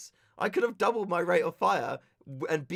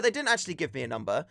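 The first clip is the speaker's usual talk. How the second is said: usual speaking voice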